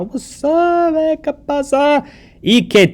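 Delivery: high, playful what's up; high, loud casual hey; casual slangy greeting. Shown as a demonstration. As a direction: toss it off like a teen greeting a friend. Teacher voicing casual greeting.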